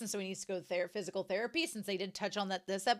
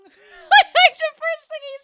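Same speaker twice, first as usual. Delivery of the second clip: words squeezed out through real laughter; laughing; 18 dB louder, much slower than usual. Real, not acted. Host cracking up at Kumatani's blunt reply, laughing. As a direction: laugh through it, high and loud.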